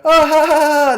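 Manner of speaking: high, loud sarcastic ha ha ha; mock laugh. Vlogger mocking the sitcom's fake laugh, high and loud.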